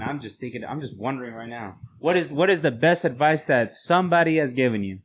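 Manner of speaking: normal talking voice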